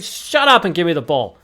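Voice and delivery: hammed-up fed-up exclamation; impatient retort. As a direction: cut off the rule-lister in mock exasperation. Acted retort to someone listing rules.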